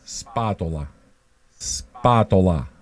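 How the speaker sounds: slow, low Spatola, SPAH-toh-lah; Italian pronunciation. Shown as a demonstration. Schooling New Jersey Italians on the name, Spatola as slow SPAH-toh-lah. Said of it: "You, you New Jersey Italians".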